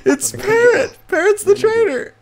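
frantic high. Real, not acted. Just killed, naming the traitor.

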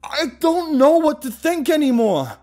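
loud, high, slow bellow. Acted. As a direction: bellow it in despair. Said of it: "I bellowed".

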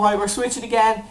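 ordinary talk

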